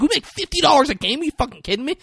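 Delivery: high-pitched, fast outrage; indignant protest. Retold or imitated. Cheerleaders complaining about their fifty-dollar pay, indignant. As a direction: protest in high-voiced disbelief.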